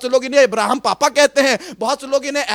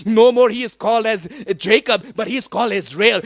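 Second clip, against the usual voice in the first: usual speaking voice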